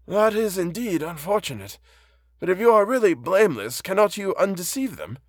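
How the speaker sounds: put-on high-pitched, gentle old blind man; kindly old man. Reads the blind old man's part.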